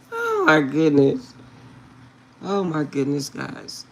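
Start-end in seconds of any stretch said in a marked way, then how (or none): none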